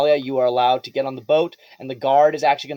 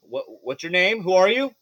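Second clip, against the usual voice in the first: drow guard; 4 semitones higher, 3 dB louder than usual. Acted. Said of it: "you are stopped by a drow guard".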